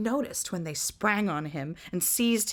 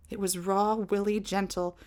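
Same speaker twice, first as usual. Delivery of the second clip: ordinary delivery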